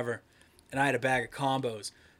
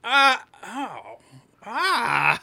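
higher, louder flustered stammer; stunned; 8 semitones higher, 6 dB louder, much slower than usual. Retold or imitated. Retelling being roasted by a classmate, flustered stammer. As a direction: caught off guard, sputtering.

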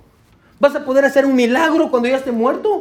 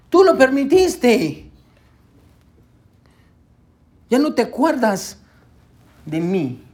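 psalmist blaming God; 2 semitones higher than usual. Acted. Preacher quoting the psalmist's reproach to God, raised then fading. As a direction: cry out at God, then let it fade. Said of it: "El salmista está diciendo".

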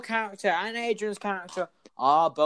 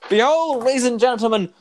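grand announcement; 9 semitones higher, 10 dB louder than usual. Acted. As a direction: announce it like a showman.

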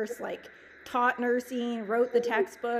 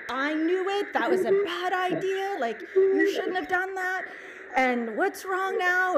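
alarmed; 7 semitones higher than usual. Retold or imitated. Retelling alarmed reaction to crying mom.

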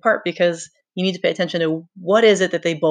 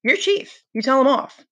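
a lightly voiced boy, pitched higher; Simon urging; 7 semitones higher than usual. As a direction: nudge him to act, a bit cautiously. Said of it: "Simon poked his head out carefully".

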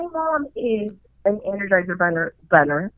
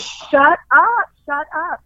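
higher-pitched retold shut-up; fed up; 6 semitones higher, 4 dB louder, slower than usual. Family tired of her panicking, acted fed up, pitched higher. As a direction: imitate relatives who have had enough. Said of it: "they're tired of me".

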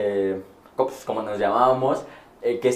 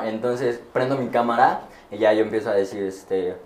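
ordinary talk, nothing marked

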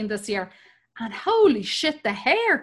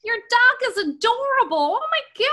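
put-on son's gushing Canadian accent, pitched way up; mock Canadian kid cooing over a dog; 11 semitones higher than usual. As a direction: imitate a kid's high, swooning Canadian "oh my God". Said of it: "'cause he, he has a Canadian accent".

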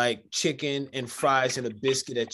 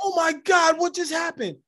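high, shocked cry; shocked kid; 14 semitones higher, 4 dB louder than usual. Retold or imitated. Young Paul seeing the headless chicken run, shocked high cry. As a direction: yelp in horror at the running chicken.

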